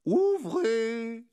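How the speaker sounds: high, drawn-out singsong call; amused, high-pitched calling; amused sheriff. Acted. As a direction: sing it out teasingly through the door. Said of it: "dit une voix amusée".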